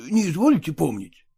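plain reading voice

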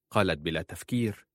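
ordinary delivery

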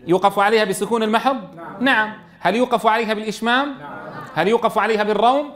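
usual speaking voice

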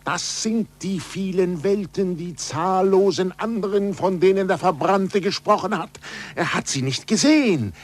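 awed and ecstatic, voice lifted high; rising excitement. Acted. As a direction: proclaim it as a revelation. Galileo shows the stars.

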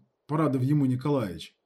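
ordinary delivery

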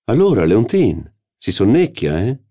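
loud, high teasing call to a dozing wife; teasing shout. A narrator's reading. Professor shouts at his dozing wife, loud and teasing. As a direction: rouse her with a mocking shout. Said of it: "le gridò il professore".

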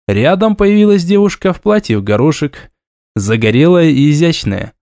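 plain reading voice

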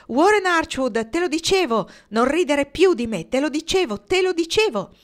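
high, loud gloating delight; I told you so, bursting with joy; triumphant excitement. A narrator's reading. Marnie gloating to Archwood, excited and triumphant.

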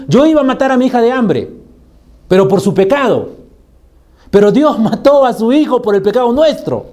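loud, high-pitched exhortation; impassioned preaching. Real, not acted. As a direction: drive it home to the congregation at full force. Predicador exhorta a toda fuerza. (prédica encendida, fuerte y aguda)

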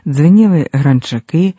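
ordinary delivery

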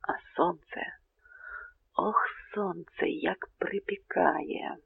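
whispered, slow and breathless in the heat; a tired colonel whispering about the scorching sun; weary whisper. Acted. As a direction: sigh it out under your breath, sun-beaten.

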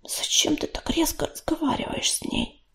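hushed, whispered question; quiet reproach. A narrator's reading. Песчаная буря questions her mate's harshness, in a hush. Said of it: "тихо сказала огнезвёзду".

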